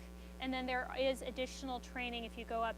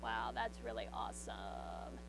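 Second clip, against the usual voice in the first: low, slow creaky fry; vocal fry; 13 semitones lower, slower than usual. Shown as a demonstration. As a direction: drag the words out in a creaky teen fry. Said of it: "which is kind of that".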